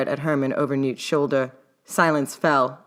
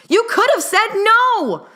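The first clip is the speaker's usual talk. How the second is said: high, slow, loud protest; indignant yell; 7 dB louder, slower than usual. A narrator's reading. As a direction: drag it out in outrage. Newt yells, waving arms.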